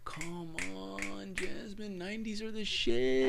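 high, soft, drawn-out wheedle; teasing plea. Real, not acted. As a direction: coax it in a high, stretched voice. Teasing pleading with co-host.

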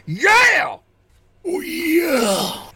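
high, slow, strained hype shout; over-the-top drawn-out "yeah" voice; ringside commentator. Acted. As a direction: holler the upset like a wrestling announcer. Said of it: "And you hear".

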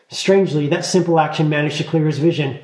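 usual speaking voice

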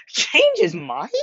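high, slow mocking disbelief; incredulous. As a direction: stretch it out like it's absurd. Mocking the teacher's absurd claim.

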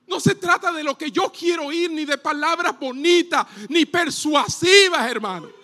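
prédica enérgica. Real, not acted. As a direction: preach it at full force, pitched high above normal talk. Preaches at full force. (predicación enérgica, voz alta y aguda)

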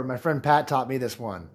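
usual speaking voice

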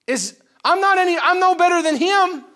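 high, loud, rising alarm; a frightened disciple crying out high and loud; panicked disciples. Retold or imitated. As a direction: voice their terror of being next. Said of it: "They're sitting there thinking".

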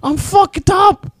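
very high, loud panicked mom voice; hammed-up mother reliving her paranoia; a mom telling her son how high she got, in a shrill mock voice; imitating mom. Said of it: "She's like".

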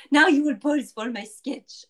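loud, hammed-up bad French accent; terrible French accent. Shown as a demonstration. Artist orders guests to pose, terrible French accent. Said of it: "That was a terrible French accent".

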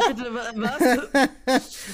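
Doppeltake. Real, not acted. Reacts to "Rudelbumsen.

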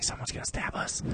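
hushed, frightened whisper to himself; a barely voiced inner panic; scared whisper. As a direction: breathe it like you're sure of danger.

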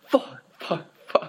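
playful rapid-fire F-bombs; mock repeated swearing. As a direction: imitate a friend cursing at each sting. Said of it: "dropping the F-bomb, like, on repeated".

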